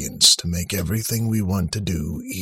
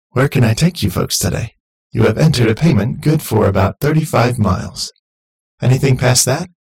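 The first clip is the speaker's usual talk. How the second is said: loud, higher, upbeat machine voice; cheerful automated voice; 6 semitones higher, 7 dB louder than usual. A narrator's reading. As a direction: greet them like a chirpy robot host. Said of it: "the voice said from the front".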